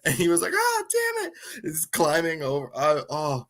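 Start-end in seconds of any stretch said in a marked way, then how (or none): 0.5-1.3 very high imitated yelp; pained yelp. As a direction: squeal it, mimicking a man in pain. Imitates man hurt by mousetraps.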